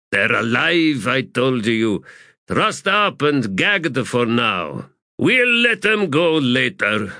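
insist, slightly put out; defensive sapper. Acted. Shaman reassuring corporal about captives, defensive.

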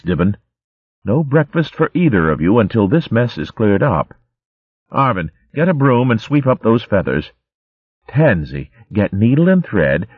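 lightly voiced stern nurse; mild scolding. Sister Cicely scolding the two miscreants, mildly stern. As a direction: order them about firmly. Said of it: "still scolding".

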